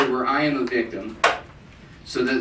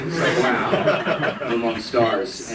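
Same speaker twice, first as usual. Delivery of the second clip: ordinary talk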